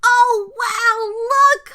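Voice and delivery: put-on very high, very loud child's yell; hollered. A narrator's reading. Baby Bear spotting someone in bed, hollering. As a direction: holler it like an excited little one. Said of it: "hollered Baby Bear".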